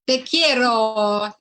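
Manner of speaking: loud, drawn-out Spanish declaration; big, slow Spanish flourish; Spanish accent. Shown as a demonstration. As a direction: stretch it out loudly in a Spanish accent. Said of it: "say something in a Spanish accent".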